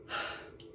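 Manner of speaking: soft, breathy exhale of frustration; weary sigh. Real, not acted. Venting about feeling stuck.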